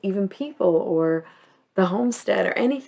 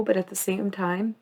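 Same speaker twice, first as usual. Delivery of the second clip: ordinary talk, nothing marked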